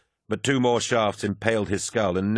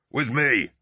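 short, high rallying shout; shouted command; 6 semitones higher than usual. A narrator's reading. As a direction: shout for the men to follow. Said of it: "Loken shouted".

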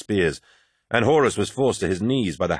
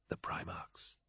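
very soft whispered echo; whispered agreement; 22 dB quieter, slower than usual. A narrator's reading. Agreed Sejanus.

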